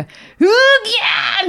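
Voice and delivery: drawn-out high mock wail of a kid losing; imitating a child's frustrated howl. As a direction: wail it out slowly like a sore loser. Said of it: "どういう叫び声なん".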